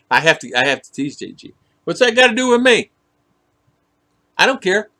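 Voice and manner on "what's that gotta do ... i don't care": louder, higher mock indifference; teasing. Host pretends not to care to tease J G, mock challenge. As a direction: playfully brush them off, loud and high. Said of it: "I'm teasing J G".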